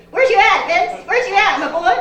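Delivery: loud, high call across the room; hollering for Vince in the audience. Real, not acted. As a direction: shout out to a buddy in the crowd. Calling out to Vince in crowd.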